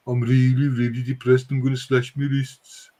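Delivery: put-on mopey Birmingham accent; gloomy Brummie. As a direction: moan it in a depressed Brummie voice. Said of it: "manic depressive Brummie".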